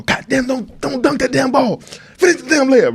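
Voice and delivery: high, yelling coach voice; Coach Lou impression. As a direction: bark it like an angry basketball coach. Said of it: "doing the Coach Lou impression".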